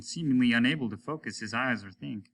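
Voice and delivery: usual speaking voice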